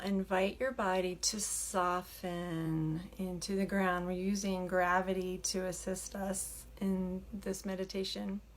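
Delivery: soft, unhurried meditation voice with long pauses; hushed, settling delivery, leaving room to breathe; guided meditation. Acted. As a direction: gently guide them into stillness. Leads a meditation.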